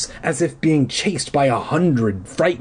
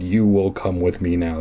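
mild deep villain line; half-voiced menace; lighter repeat of the growl; 7 semitones lower than usual. Reader quoting the caped man, mildly deep. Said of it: "that was a pretty blatant thing he said".

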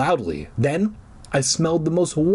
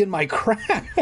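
breaking into a laugh mid-line; 8 semitones higher than usual. Real, not acted. Reader laughing at her own joke, laughing. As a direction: crack up at the rude word.

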